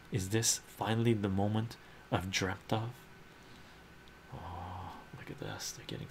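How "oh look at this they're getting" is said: hushed aside; excited whisper at the page. Real, not acted. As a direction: whisper it to the viewers.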